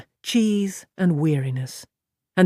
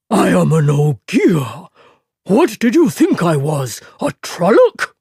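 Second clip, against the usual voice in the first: slow, louder, indignant boom; 5 dB louder than usual. A narrator's reading. Offended Ogier Loyal booming at the innkeeper. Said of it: "the booming voice".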